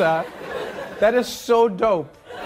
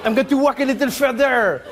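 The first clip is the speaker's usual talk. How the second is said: hammed-up French-accented storyteller; wandering Frenchman telling his tale in accent. Comedian as French immigrant recounting his walk, mock French accent. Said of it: "Your accent".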